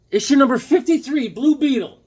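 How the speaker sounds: high, loud comic-book announcement; excited reveal. Real, not acted. Showing viewers his Blue Beetle comic, excited announcement. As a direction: present it like a big prize.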